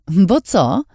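mock cartoon-villain taunt, high and loud. A narrator's reading. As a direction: play the bad guy, teasing. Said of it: "udając zły charakter z kreskówek". (żartobliwie udawany złoczyńca z kreskówki)

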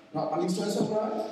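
normal talking voice

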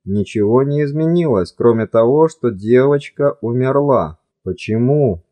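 ordinary delivery

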